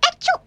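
high little sneeze; squeaky sneeze. A narrator's reading. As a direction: sneeze like a tiny mouse. Mouse sneezes.

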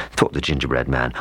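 usual speaking voice

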